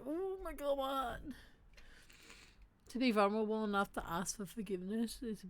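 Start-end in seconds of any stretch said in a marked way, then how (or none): 0.0-1.2 high mock gasp of surprise; a pitched-up, lightly acted oh my God; stunned disbelief. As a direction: act floored by the apology. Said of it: "You know, like".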